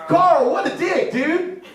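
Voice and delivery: high, loud, stretched-out complaint; indignant shout. Real, not acted. Comic calling out his absent friend, indignant shout. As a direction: holler across the room at a friend.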